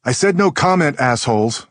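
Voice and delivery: furious yell at the reporters; angry shout. Acted. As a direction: shout it angrily. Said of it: "Mia wails".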